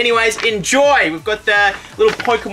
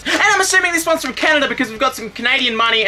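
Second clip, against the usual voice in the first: usual speaking voice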